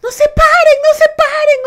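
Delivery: high, loud yell to stay down; urgent warning. Retold or imitated. Coworkers yelling at everyone not to stand up, high loud yell. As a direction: order everyone to stay on the floor. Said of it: "nuestras compañeras".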